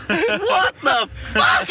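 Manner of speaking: very high, slow, drawn-out disbelief; stunned. Retold or imitated. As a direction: stretch it out high like you can't believe it. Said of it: "he reckons".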